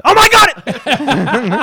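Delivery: bursting into laughter, loud and high; laughing. Real, not acted. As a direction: cracks up mid-announcement. Breaks up laughing mid-bit.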